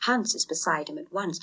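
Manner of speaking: plain reading voice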